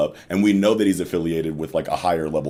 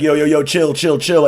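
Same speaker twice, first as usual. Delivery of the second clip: mock panicked calling; 10 semitones higher, 7 dB louder than usual. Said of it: "everyone's like".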